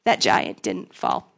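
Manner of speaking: whispered, slow letdown; deflated whisper. Retold or imitated. Back home after the conference, slow whispered disappointment. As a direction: breathe it out in disbelief. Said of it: "you're like".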